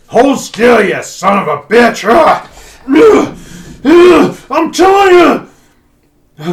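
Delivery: high, loud furious yelling at a horse; frustrated smith yelling at a horse that won't cooperate; angry yell. Acted. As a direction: bellow at a stubborn horse.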